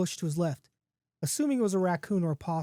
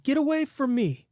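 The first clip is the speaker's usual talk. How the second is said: frightened cry; 8 semitones higher than usual. A narrator's reading. Man falls back from giant wolf.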